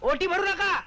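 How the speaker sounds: sudden high-pitched protest; alarmed objection. Acted. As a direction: cut in urgently, voice jumping up. (घाबरून, चढ्या आवाजात)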